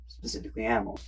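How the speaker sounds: breathy near-whisper aside. Real, not acted. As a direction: drop to a whisper. Trailing aside.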